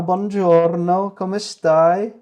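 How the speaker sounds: high, loud, slow cooing in Italian. Real, not acted. Greeting his cat in Italian, high cooing.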